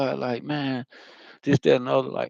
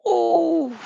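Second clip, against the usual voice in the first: a much higher, louder ooh of wonder; high awed ooh; 4 dB louder than usual. Retold or imitated. Overwhelmed by spiritual visions, a high amazed ooh. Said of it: "being me, it's like".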